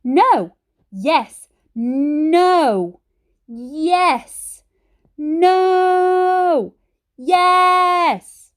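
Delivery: put-on a childish yes-no argument building to a scream; escalating shouting match. A narrator's reading. Shouting match, Granny and Ben.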